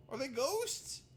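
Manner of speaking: mock bewildered. Continues mock confusion.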